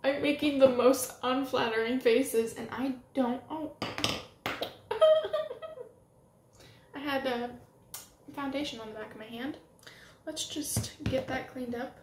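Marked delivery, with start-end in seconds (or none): 3.8-5.9 high giddy burst of laughter, louder; squealing laugh. Real, not acted. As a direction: let out an overexcited shrieking laugh. Overcome by her own faces.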